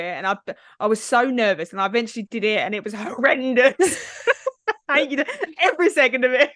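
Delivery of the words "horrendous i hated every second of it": high, laughing through the words; helpless amused complaint. Real, not acted. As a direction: laugh while admitting the misery. Laughs telling of horrible zip line.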